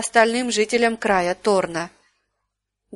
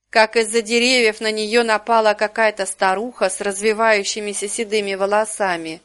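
ordinary delivery

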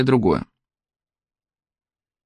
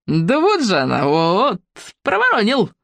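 gloating innkeeper; 3 semitones higher, slower than usual. Acted. Innkeeper gloats catching girl.

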